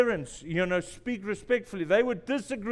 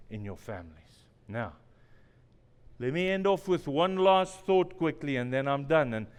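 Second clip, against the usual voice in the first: ordinary delivery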